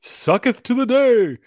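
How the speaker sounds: high, loud, slow mock-archaic cry; mock proclamation. Co-host mocking "this day sucks", grandly proclaimed. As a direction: proclaim it like a hammed-up herald.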